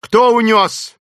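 high, loud frantic question; shouting. A narrator's reading. Professor Porter asking who took his daughter, shouting. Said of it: "закричал профессор Портер".

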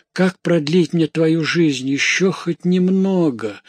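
slow, high grieving plea; tearful lament. A narrator's reading. Mother longs to prolong daughter's life, tearful lament. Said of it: "рыдая".

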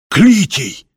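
high exclamation of recognition; startled cry. A narrator's reading. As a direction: cry out the name in surprise. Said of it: "в один голос вскрикнули".

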